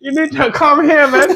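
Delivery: put-on high, drawn-out Chinese-accent caricature; laughing, hammed-up Chinese-accented seller; mock Chinese accent. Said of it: "imagine one Chinese man".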